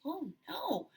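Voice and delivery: ordinary talk, nothing marked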